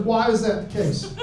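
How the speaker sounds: normal talking voice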